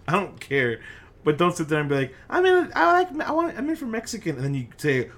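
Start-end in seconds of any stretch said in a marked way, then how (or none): 2.3-4.4 very high-pitched mocking voice; squeaky imitation of a friend; mock food craver. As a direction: imitate an eager diner way up high. Imitating someone craving Mexican food.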